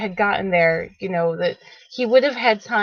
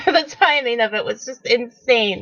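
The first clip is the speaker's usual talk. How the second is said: ordinary talk, nothing marked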